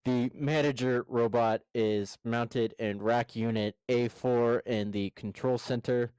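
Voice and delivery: ordinary delivery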